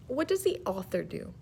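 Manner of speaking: usual speaking voice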